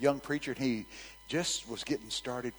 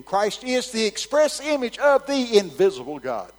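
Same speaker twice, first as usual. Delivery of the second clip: loud high preaching; preaching at full force; 11 semitones higher, 11 dB louder than usual. Acted. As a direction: proclaim the verse. Preached verse quote.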